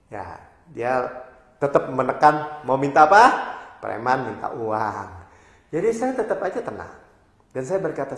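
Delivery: normal talking voice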